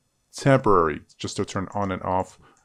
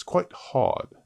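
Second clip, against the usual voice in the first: put-on slow, higher British accent; 6 semitones higher than usual. Tutor admitting the accent is hard, British accent. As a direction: slip into careful put-on British English. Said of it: "I'm not mocking the British accent".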